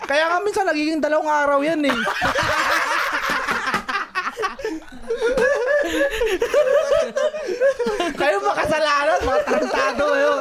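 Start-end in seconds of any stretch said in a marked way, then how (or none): 2.0-8.1 long high laugh; real helpless laughter; long laughing fit. Real, not acted. Bursts out laughing at teasing. 8.2-10.3 high, laughing mock scolding; laughing while blaming the group; laughing accusation. Real, not acted.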